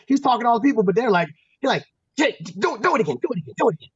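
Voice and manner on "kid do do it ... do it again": coach's Penguin voice begging for a repeat; lightly voiced eager Penguin. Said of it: "he spoke like the Penguin".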